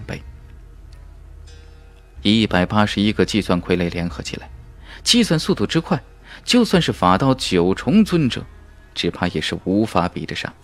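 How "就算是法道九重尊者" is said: ordinary delivery, nothing marked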